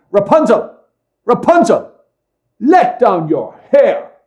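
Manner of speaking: high ringing call up to a tower; loud call. A narrator's reading. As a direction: call out loud and high, slowing on the last words. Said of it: "she would cry".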